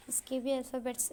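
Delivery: usual speaking voice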